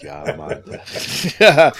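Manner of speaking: a fuller go at a Jamaican "Yeah, man", admittedly bad; Jamaican accent. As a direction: lean harder into the Jamaican accent, laughing at yourself. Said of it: "I'm terrible at the Jamaican accent".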